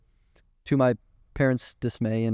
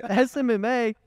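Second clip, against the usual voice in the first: high, incredulous mocking squeal; high-pitched mockery; 9 semitones higher than usual. Brother ridiculing his sibling's business plan, high-pitched mockery. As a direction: imitate a brother cracking up, much higher than usual. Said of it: "laughing like a high-pitched girl".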